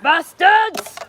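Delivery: shouting. Real, not acted. Daughter echoing mum's shout.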